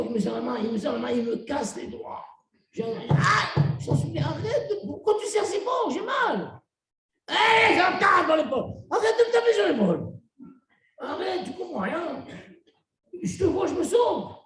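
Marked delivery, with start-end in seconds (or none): 2.7-12.2 mock pained yelps. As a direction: ham up the crushed hand and sore shoulder. Mimics victim of crushing handshake. (cris de douleur joués, aigus et forts)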